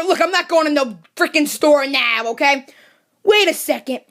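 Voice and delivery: ordinary talk, nothing marked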